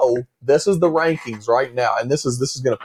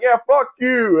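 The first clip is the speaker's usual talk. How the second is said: mock angry yell; 10 semitones higher, slower than usual. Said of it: "And he's like".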